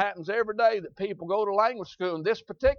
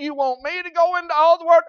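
incredulous question; 8 semitones higher, 8 dB louder than usual. Pretend. Preacher voicing a doubting believer.

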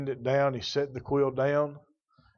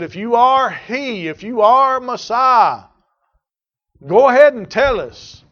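much higher, much louder, slow taunting demand; mocking challenge; 9 semitones higher, 11 dB louder than usual. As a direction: hurl it as a sneering dare.

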